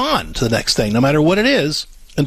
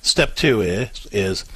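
usual speaking voice

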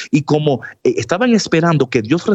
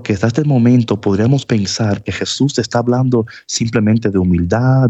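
ordinary talk

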